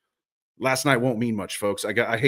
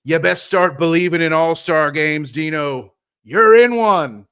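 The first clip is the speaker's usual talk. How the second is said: slow, louder movie line, rising high on the last words; movie quote; 4 semitones higher, 8 dB louder, slower than usual. Pretend. Host quoting the pirate movie to the coach, dramatic. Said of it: "what's the Pirates of the Caribbean?".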